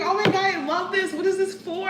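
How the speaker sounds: gushing lady. Retold or imitated. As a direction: rave about it like she's thrilled. Lady loving the stretch.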